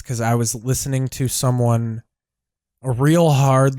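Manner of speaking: ordinary talk, nothing marked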